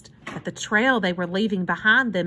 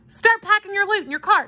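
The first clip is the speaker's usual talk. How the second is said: raised-pitch, commanding urgency; urgent order; 10 semitones higher, 4 dB louder than usual. A narrator's reading. Hobo hurries children to pack up, ordering. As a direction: give the order briskly and firmly. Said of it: "he ordered".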